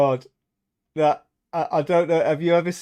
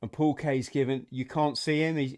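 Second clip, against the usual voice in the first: ordinary talk, nothing marked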